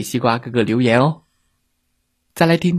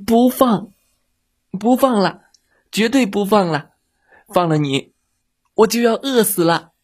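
high, stubborn mock-fierce no; fierce refusal; 6 semitones higher than usual. Little monster refuses to free the whale, mock-fierce. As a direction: refuse like a hungry little brat. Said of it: "小妖怪凶巴巴地说".

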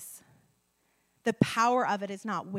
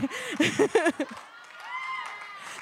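delighted high laugh; gleeful peal; high burst of laughter. Real, not acted. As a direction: laugh out in joy. Laughs at healing joke.

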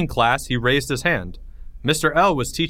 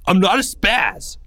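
loud, higher yell; 9 semitones higher, 5 dB louder than usual. Acted. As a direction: yell it back, indignant. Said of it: "yelled Greg".

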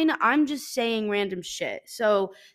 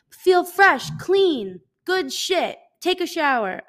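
mock cheerful advice; 7 semitones higher, 5 dB louder, slower than usual. Mock self-help advice voice.

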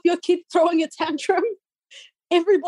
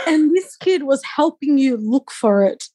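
normal talking voice

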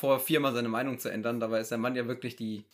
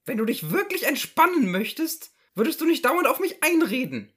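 higher, pestering housewife voice; nagging wife; 13 semitones higher, 8 dB louder than usual. Acted. Wife nudging her resting husband, nagging. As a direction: fuss over him like a nagging wife. S0 plays wife in Loriot sketch.

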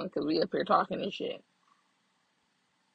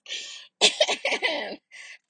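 laugh; 8 semitones higher than usual. Real, not acted. Laughing at own mock sob.

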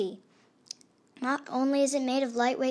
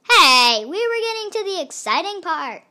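loud, higher protest; indignant shout; 7 semitones higher, 9 dB louder than usual. Acted. Little Red to the narrator, loud indignant protest. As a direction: snap back at the interruption. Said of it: "said Little Red to the narrator".

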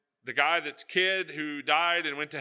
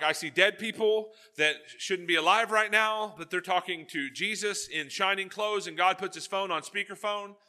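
loud, higher, awestruck retelling; excited Peter; 6 semitones higher, 5 dB louder than usual. Retold or imitated. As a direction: blurt it out like a stunned witness. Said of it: "He was like".

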